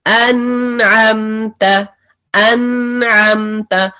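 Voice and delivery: अनअमत recited slowly with a medium noon; measured Quranic reading; slow recitation. Shown as a demonstration. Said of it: "इसी तरह से है नून".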